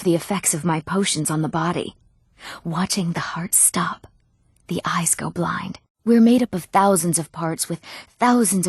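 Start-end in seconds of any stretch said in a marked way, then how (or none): 2.6-5.8 slow, soft whisper; morbid whisper. Acted. Relishing effects of her potions.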